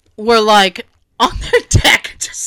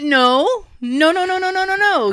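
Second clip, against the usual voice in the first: rapid-fire string of no's climbing higher; acted-out frantic protest, pitch rising; mock-alarmed refusal; 7 semitones higher than usual. Acted panicked refusal to friend.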